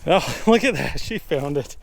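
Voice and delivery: delighted laugh through words. Real, not acted. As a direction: laugh with relief. Laughing as dog finds it.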